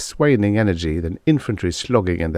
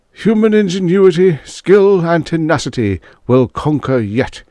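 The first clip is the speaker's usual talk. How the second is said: much higher and louder, a rallying cry; rousing Prime Minister; 10 semitones higher, 6 dB louder than usual. Acted. Prime Minister closing his broadcast to the nation, soaring louder rallying climax. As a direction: rouse the nation with a triumphant finish. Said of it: "he concluded".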